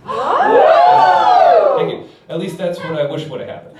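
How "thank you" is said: high drawn-out triumphant thanks; milk the applause with a long high thank you. Acted. Comic soaking up the crowd's cheers, high and drawn out.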